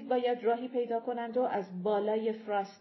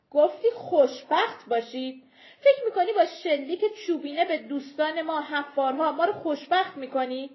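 louder, sharper accusing challenge; bitter retort; 5 semitones higher, 7 dB louder than usual. Acted. Small folk challenging the giant leader, bitter anger. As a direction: throw his words back at him.

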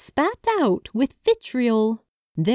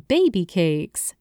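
plain reading voice

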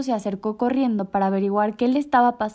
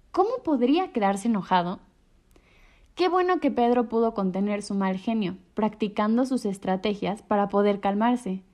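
plain reading voice

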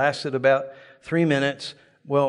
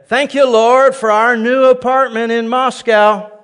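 much louder, higher and slow praise; bold declaration; 8 semitones higher, 7 dB louder, slower than usual. Retold or imitated. Preacher declaring faith against his doubts, loud and slow. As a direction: shout the thanks over the doubts. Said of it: "I'd say".

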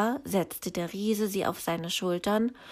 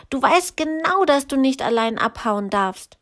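scolding brother; 5 semitones higher, 6 dB louder than usual. A narrator's reading.

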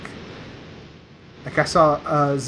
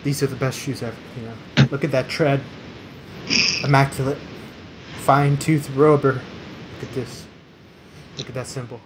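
monotone reviewer. Retold or imitated. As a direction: list selling points in a dead monotone. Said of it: "he just talks so monotone".

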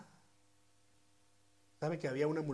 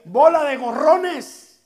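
slow, loud, higher mocking jab; blunt Mexican put-down; 10 semitones higher, 18 dB louder, much slower than usual. Preacher calls the crowd freeloaders in Mexican slang, loud mock scolding. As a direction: spit it out like a scolding nickname. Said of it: "si lo pongo en buen mexicano, yo diría".

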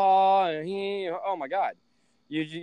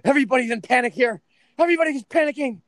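much higher, louder, fast mock panic; panicking mom; 9 semitones higher, 7 dB louder, faster than usual. Mom phoning from the store in a higher, fast mock panic. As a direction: imitate mom shrieking from the store. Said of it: "She goes, she goes".

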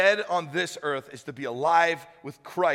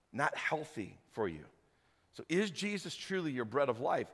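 soft reflective preaching; 4 semitones lower, 7 dB quieter than usual. Acted. Pastor leading the church into worship, quiet and reflective. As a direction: draw them gently into worship.

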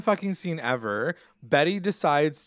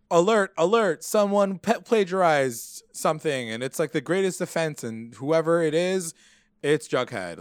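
raised, higher voice, alarm call; mock announcement; 5 semitones higher, 4 dB louder than usual. Teacher announcing to the whole class, mock alarm. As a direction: ham up a principal sounding the alarm.

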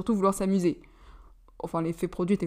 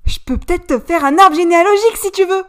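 mock serious offer; 10 semitones higher, 10 dB louder than usual. A narrator's reading. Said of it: "avec un air très sérieux".

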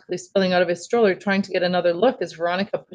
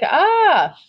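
loud, high, drawn-out "ah"; hammed-up little-kid scream, held long; mock scream; 14 semitones higher, 6 dB louder than usual. A narrator's reading. Jamie showing Mom how he screamed, mock scream. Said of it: "It was like".

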